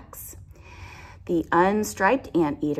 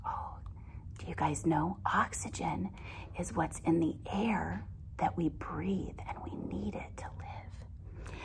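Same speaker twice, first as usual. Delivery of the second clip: whispered aside; 8 dB quieter than usual. Real, not acted. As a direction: lean in and whisper the question. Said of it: "teacher whispers aside to children".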